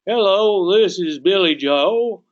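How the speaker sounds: loud, high Southern drawl; Southern accent. Acted. Southerner answering the agent's call, broad Southern drawl. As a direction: pick up the phone with a slow Southern drawl. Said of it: "I'm mimicking a Southern accent".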